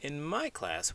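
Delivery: ordinary delivery, nothing marked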